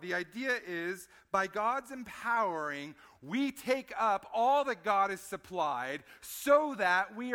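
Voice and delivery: loud, high, driving sermon voice; full-force preaching. Acted. Pastor urging the congregation to take up armor, loud and driving. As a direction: preach it hard to the pews.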